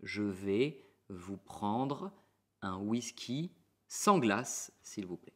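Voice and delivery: very slow, careful formal request. Shown as a demonstration. Teacher models the formal order to a waiter slowly. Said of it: "pero con la manera formal".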